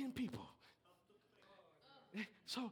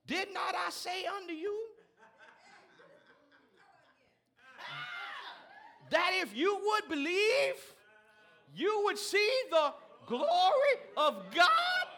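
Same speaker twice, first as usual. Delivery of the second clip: slow, loud, higher rebuke; slow ringing preacher shout; preaching Jesus' rebuke; 9 semitones higher, 13 dB louder, slower than usual. Acted. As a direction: thunder it from the pulpit. Preacher voices Jesus with force.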